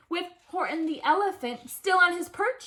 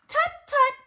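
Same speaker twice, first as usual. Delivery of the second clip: tut-tutting; 9 semitones higher than usual. A narrator's reading. Maisie chides the elephant.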